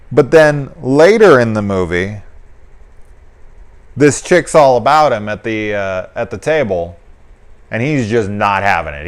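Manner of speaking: ordinary delivery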